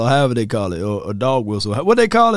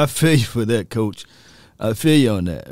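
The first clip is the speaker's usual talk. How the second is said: ordinary delivery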